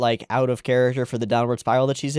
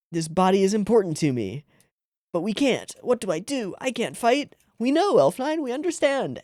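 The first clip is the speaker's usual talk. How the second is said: put-on lightly raised, anxious girl voice; pleading girl voice; 8 semitones higher than usual. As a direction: plead helplessly, a touch higher. Voicing Elf Nine's plea.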